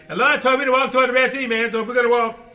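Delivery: hyped-up follower bragging; excited quote. Retold or imitated. A follower boasting to everybody, high and fast. People voiced telling the Red Sea plan.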